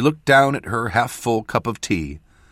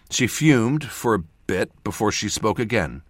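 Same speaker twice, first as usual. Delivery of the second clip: ordinary delivery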